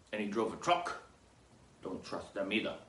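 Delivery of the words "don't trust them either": ordinary talk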